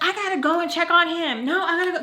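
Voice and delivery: high-pitched retold protest; imitated agitated argument; mock insistence.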